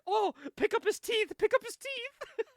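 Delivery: very high-pitched imitation of a flustered attendant; mock alarm. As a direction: squeal it urgently, mimicking a flight attendant. Flight attendant panics over fallen teeth.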